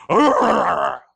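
loud growling bark; imitated dog snarl. Preacher voicing the dog by the car, loud snarl.

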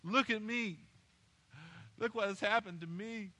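acted woe-is-me moaning; mock self-pitying moan. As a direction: play the sufferer wanting pity. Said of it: "And we moan".

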